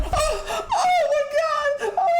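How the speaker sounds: mock terrified victim. Mocking a horror victim, laughing mock shriek. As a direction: play the frozen horror victim, squealing in mock panic. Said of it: "they see them like".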